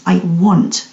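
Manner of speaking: "want" leaned on, pause after it; stress on want. Shown as a demonstration. Coach offers listeners a new sentence, stress on "want". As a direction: land firmly on "want". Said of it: "the emphasis on the word want".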